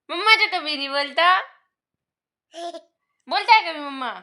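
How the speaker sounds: high, mock-scolding sing-song. Real, not acted. Playfully scolding baby.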